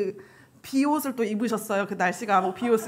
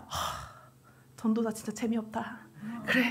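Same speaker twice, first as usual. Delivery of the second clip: unimpressed sigh; 12 dB quieter than usual. Retold or imitated. Friend giving up on the dull evangelist, soft exasperated sigh. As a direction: sigh out, then much softer words.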